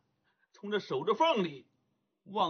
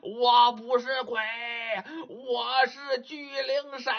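desperate ghost's shout; 5 semitones higher than usual. Acted. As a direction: yell it out like a ghost scrambling up a knife mountain. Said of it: "一边爬一边还喊".

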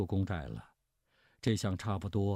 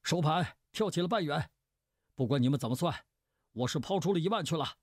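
high-pitched, hurried calling out; high voice racing to the end; loud announcing shout; 8 semitones higher, faster than usual. A narrator's reading. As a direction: call the deal out in a high, excited rush. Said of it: "大声喊道".

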